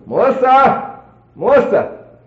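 high, loud call of a name; high-pitched searching holler; calling out for someone. Retold or imitated. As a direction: holler her name through the trailer. Calling out for his wife.

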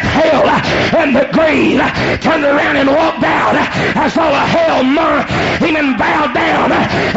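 high, loud, sing-song preaching; whooping preacher. Acted. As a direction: ride the climax of the sermon. Preacher whoops at full force.

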